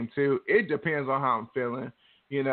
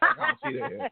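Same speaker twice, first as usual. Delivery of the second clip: high, laughing through the words; 12 semitones higher than usual. Real, not acted. To her co-host about ignoring the Girl Scouts, laughing. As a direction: giggle while admitting it.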